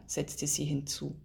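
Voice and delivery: ordinary delivery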